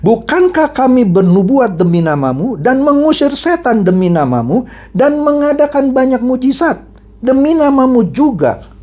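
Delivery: high, insistent plea; crying out. Acted. Many people plead with the Lord on the last day, crying out. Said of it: "banyak orang akan berseru kepadaku".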